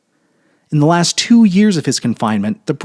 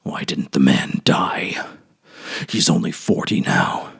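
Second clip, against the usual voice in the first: despairing whisper; 9 semitones lower, 6 dB quieter than usual. A narrator's reading. Old banker wishing the prisoner dead, whispered. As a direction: breathe it out, hopeless.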